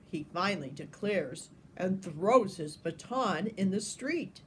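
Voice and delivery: usual speaking voice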